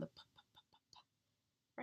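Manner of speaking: whispered, repeated "p" pops; voiceless p sounds. Shown as a demonstration. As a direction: demonstrate the "p" with lip pops only. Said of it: "the bilabial sound".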